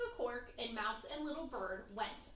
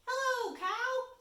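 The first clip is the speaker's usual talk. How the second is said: high, louder, slow mouse greeting; high little mouse; 13 semitones higher, 7 dB louder, slower than usual. Acted. Mouse greeting Cow, high and slow. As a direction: greet her high and drawn out.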